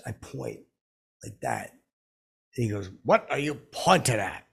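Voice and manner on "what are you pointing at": raised, louder put-on celebrity voice; higher impression, loudly challenging; Morgan Freeman. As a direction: imitate an indignant star questioning a pest. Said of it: "He says that to me".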